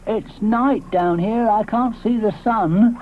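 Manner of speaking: slow, lazy drawl; slow Ally. Acted. Ally lies beside Flower, slow lazy voice. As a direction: say it lazily lying down.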